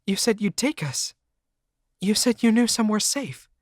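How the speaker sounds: voiced high as a frightened girlfriend. A narrator's reading. Cindy pleading with narrator.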